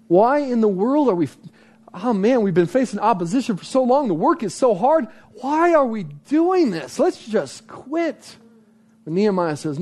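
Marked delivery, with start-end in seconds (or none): 0.1-8.3 high, whining, worn-out protest; exasperated complaint. Acted. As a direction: moan like someone ready to quit. Voicing the discouraged people's complaint.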